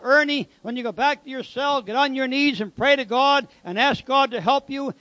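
normal talking voice

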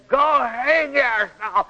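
slow, high go hang yourself with hang stretched; harsh retort. Shown as a demonstration. Wife fully shut off telling husband to go hang himself, harsh and slow. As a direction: bite down on "hang" and drag it. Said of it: "that means her spirit's closed quite a bit".

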